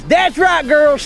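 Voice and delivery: normal talking voice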